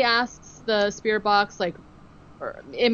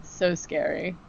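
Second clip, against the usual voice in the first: normal talking voice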